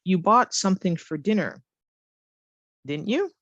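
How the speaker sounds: DIDN'T YOU lifting up at the end; rising question tag. Shown as a demonstration. Teacher models a tag requiring an answer, rising question tag. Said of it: "Requiring an answer".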